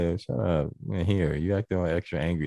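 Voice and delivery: low, soft mock scolding; playfully spicy. Handing food to a hangry partner, playfully spicy. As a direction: grumble gently, with no real malice. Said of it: "you can talk a little spicy".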